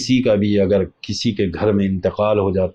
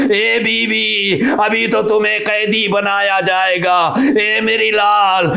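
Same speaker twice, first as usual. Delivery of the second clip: higher and higher, half-chanted grief recital; rising mourning lament; 7 semitones higher than usual. Acted. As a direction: build the lament toward a sung wail. Mourning narration rises to wail. (بلند ہوتی ہوئی نوحہ خوانی کی آواز)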